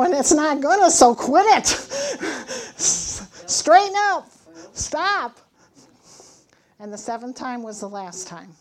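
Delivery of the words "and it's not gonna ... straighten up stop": higher, louder stern scolding; scolding mom. Retold or imitated. Mother telling her son to quit, loud stern scolding. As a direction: lay down the law to her son.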